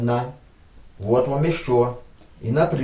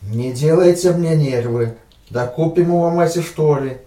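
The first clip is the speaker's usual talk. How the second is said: loud Odessa haggling father; odessa russian accent; 5 dB louder than usual. Acted. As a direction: Odessa parent pleading, louder. (одесский папа торгуется громко)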